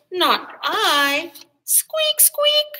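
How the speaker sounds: very high squeaky rat. A narrator's reading. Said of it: "And the rat said".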